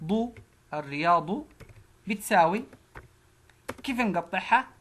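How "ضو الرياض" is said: ضو then الرياض said slowly and clearly; syllable by syllable. Shown as a demonstration. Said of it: "مد بالألف".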